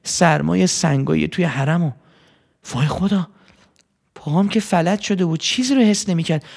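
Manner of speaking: ordinary delivery, nothing marked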